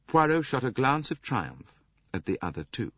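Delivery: ordinary delivery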